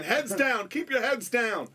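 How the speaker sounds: loud, clipped security command; urgent order. Retold or imitated. Bodyguard ordering the band into the van, loud and urgent. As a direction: bark it like a bodyguard moving the group.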